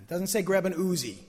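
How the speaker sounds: normal talking voice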